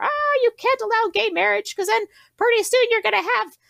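high, whiny mock preacher; mocking conservative voice. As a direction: parody a pearl-clutching moralist.

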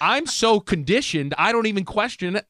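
rising indignation at himself; worked-up telling. Real, not acted. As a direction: pitch climbing in mock outrage. Embarrassed self-mocking retelling.

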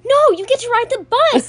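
high, loud sing-song letdown; mock cheerful mom. Mom announcing the bus to kids, high and chirpy. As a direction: sell the bad news brightly. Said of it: "you're like".

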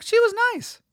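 very high, squeaky mock protest; high-pitched protest. Speaker defending himself to an offended woman, high-pitched protest. Protesting in retold exchange with women.

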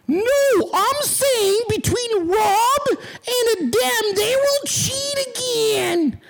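high, slow wailing mockery of a commenter; high-pitched, drawn-out mock yell; mock all-caps reading. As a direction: drag each word out high like someone begging for attention. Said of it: "'cause in all caps".

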